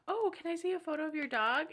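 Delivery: high-pitched curious question; put-on interested lilt; eager date. Acting out date's eager question.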